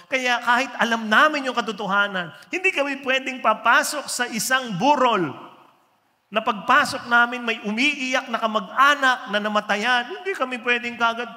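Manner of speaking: loud, high preaching voice; full-force preaching. Acted. Preacher pressing a point hard to the congregation. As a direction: drive it home like a pulpit preacher.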